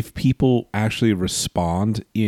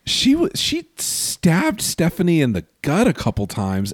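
ordinary talk

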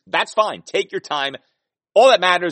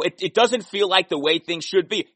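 normal talking voice

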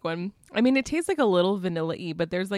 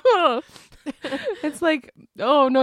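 sour face; 6 semitones higher, 6 dB louder than usual. Real, not acted. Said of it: "I'm squinting".

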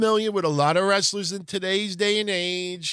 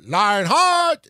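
higher, louder announcer call of the name; Japanese ring announcer; 5 semitones higher, 7 dB louder than usual. Retold or imitated. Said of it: "you would hear the Japanese announcers going".

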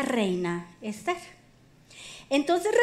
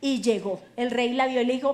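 ordinary talk, nothing marked

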